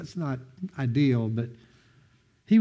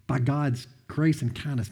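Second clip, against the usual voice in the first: ordinary talk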